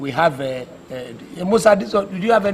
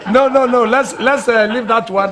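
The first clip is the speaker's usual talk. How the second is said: loud laughing refusal; 5 semitones higher, 7 dB louder than usual. Acted. President laughing off suggestion.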